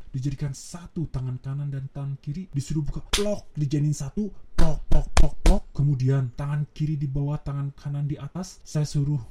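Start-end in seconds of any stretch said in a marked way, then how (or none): 4.6-5.6 clap sounds. Shown as a demonstration. Clapping hands together demo.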